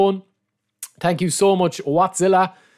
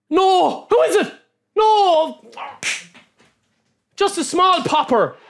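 high, sharp cry of disbelief; dismayed cry; 9 semitones higher, slower than usual. Real, not acted. Streamer sees it is not Collar, crying out high in dismay. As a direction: yelp no as the card turns wrong. Pack reveal disappoints.

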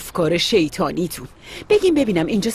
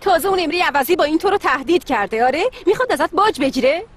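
much higher indignant questions; outraged; 10 semitones higher than usual. Acted. Mother outraged that Emre is blackmailing her daughter.

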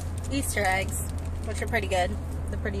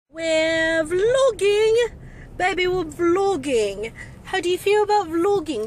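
drawn-out, higher, louder cheer; excited shout; 7 semitones higher, 5 dB louder, slower than usual. Real, not acted. To her boyfriend on camera, an excited drawn-out cheer. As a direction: shout it out thrilled to be filming.

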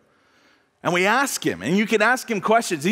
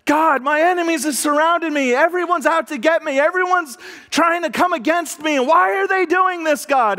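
David's desperate prayer; 8 semitones higher than usual. Retold or imitated. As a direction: cry out to God as if surrounded. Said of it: "most honest prayer in the world was David".

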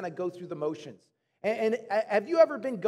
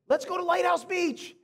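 put-on high-pitched little boy request; a kid's raised-pitch plea from the back seat; young son pleading; 8 semitones higher than usual. Young son asking parent on the drive over, high eager plea. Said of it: "he would always say to me".

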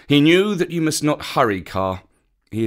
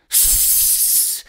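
angry snake hiss; 4 dB quieter than usual. A narrator's reading. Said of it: "snake hiss".

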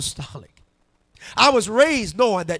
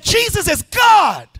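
high, stretched-out joyful shout; screamed revelation; 12 semitones higher, 8 dB louder, slower than usual. Retold or imitated. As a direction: cry it out so the whole house hears. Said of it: "I just started screaming".

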